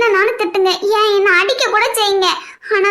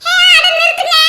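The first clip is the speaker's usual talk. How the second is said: laughing shout; 7 semitones higher than usual. Acted. Sister stops bickering laughing.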